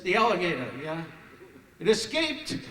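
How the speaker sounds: high, loud panicked blurt. Retold or imitated. Frantic son telling the announcer the alligator escaped, loud and high. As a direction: blurt it out in alarm.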